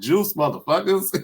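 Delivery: high, gleeful imitated comedian shout; mock Chappelle. Fan retelling a Chappelle joke, high gleeful mock voice. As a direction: crow it up high like the bit. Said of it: "he's like".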